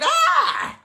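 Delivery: sudden high scream of claustrophobia; mock scream at a clingy man.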